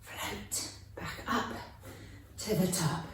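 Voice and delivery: whispered, slow and soft. Shown as a demonstration. Teacher whispering children back up to the top, hushed. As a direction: breathe the words out gently as you rise. Guiding slow float in warm-up.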